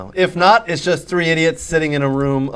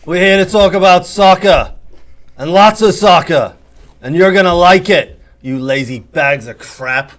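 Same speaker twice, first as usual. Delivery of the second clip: attempted Roy Kent accent; 3 semitones higher than usual. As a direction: bark it like a gruff English coach, a bit overdone. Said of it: "Kinda sound Australian".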